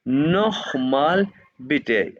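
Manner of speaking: नोखमाल बिटे said slowly, the ch in नोखमाल a throaty kh, बिटे clipped; slow German model of नोखमाल बिटे, kh sound from the throat; German pronunciation demo. Shown as a demonstration. Teacher modelling the phrase for students, slow and clear. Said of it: "नोखमाल बिटे यानी कि again please".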